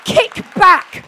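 high, roaring preacher's command; full shout. Retold or imitated. Preacher rallies congregation with God's word, full shout. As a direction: yell it out with everything you have.

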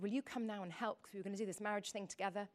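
low, soft, hurried aside; hushed aside. Retold or imitated. Wife asks husband for help mid-service, hushed aside.

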